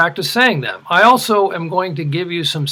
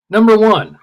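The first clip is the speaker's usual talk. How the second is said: normal talking voice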